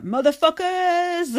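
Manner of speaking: high, drawn-out yelled greeting; mock entrance yell. Returning housemate yelling his entrance to the house, high and slow. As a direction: bellow it as a grand comeback. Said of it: "he's like".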